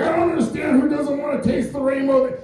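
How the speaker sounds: high, strained, hurt shout; high wailing complaint as he walks off; offended weirdo voice. Retold or imitated. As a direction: shout it like a baffled stranger. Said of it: "and he's like".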